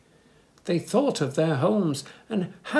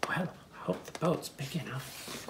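whispered worried line; soft unvoiced whisper; 7 dB quieter than usual. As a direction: breathe it like a secret. Quiet remark on boat.

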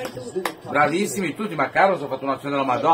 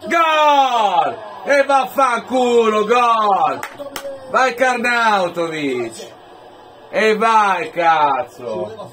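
roaring goal cry with swearing; explosive italian cursing; 5 semitones higher, much slower than usual. Real, not acted. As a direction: yell and curse for joy. Fan celebrates goal, swearing. (imprecazioni urlate di gioia)

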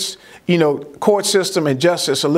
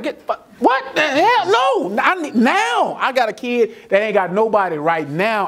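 fed up with waiting lists, push back hard; exasperated outburst; 7 semitones higher than usual. Retold or imitated. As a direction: pitch jumps way up, incredulous. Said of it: "I'm like".

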